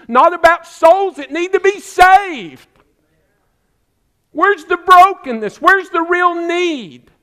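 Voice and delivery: loud, high impassioned preaching; preaching at full force. Real, not acted. Pastor challenging his congregation, impassioned loud preaching. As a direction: cry out the challenge to the church. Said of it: "preacher builds to full force".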